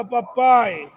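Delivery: usual speaking voice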